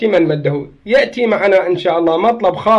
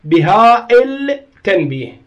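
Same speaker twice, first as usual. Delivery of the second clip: بها التنبيه slowed right down, long هاء held apart from التنبيه; slow, deliberate correction on بها التنبيه; corrective emphasis on هاء; 3 semitones higher, slower than usual. Shown as a demonstration. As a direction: stretch and separate the words in بها التنبيه. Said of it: "هاي بها مو بها يعني ضمير".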